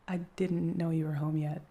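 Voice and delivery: ordinary talk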